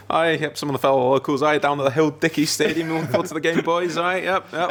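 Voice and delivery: a hint of a smug office manager greeting the lads; David Brent impression. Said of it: "sort of David Brent sort of thing".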